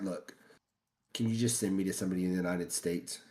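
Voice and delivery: soft, low, restrained request; quick, hushed show of patience; asking nicely. Retold or imitated. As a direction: retell a polite plea, voice held down. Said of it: "And I ask nicely".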